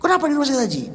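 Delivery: put-on high, thin voice of an ailing old father; a father puzzled by where he has been taken; elderly father. A sick father asking his son, in a high, frail voice.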